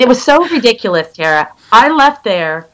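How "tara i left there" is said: ordinary delivery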